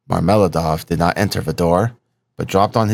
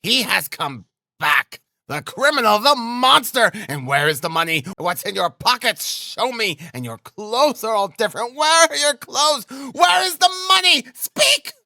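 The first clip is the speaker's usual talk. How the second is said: high-pitched frantic screaming, building to a shriek; hysterical, very high accusing shrieks; frenzied cry. A narrator's reading. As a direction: rage at him in a frenzy, voice climbing ever higher. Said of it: "she cried out in a frenzy".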